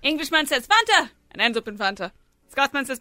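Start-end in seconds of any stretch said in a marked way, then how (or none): 0.7-1.1 higher, brisk little cry; calling out. Acted. Englishman naming his drink on the slide, calling out. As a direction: shout it lightly as a wish.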